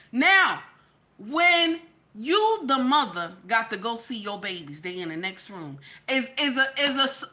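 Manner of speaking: normal talking voice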